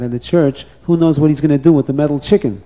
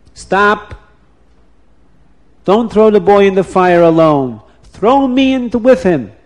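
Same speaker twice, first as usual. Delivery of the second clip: raised, higher call to stop; 6 semitones higher than usual. Acted. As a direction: stop them from the gate.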